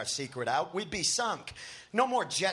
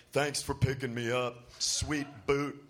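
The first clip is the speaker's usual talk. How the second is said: droned husky; 3 semitones lower than usual. A narrator's reading. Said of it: "she calls in a droned out husky".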